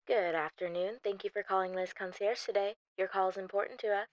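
soft, scripted switchboard greeting; receptionist phone greeting. Acted. Receptionist greeting a caller, softly scripted. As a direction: answer the line by rote.

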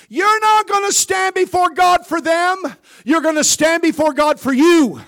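high, loud shouted preaching. Acted. Preacher to congregation, shouting to hammer it home.

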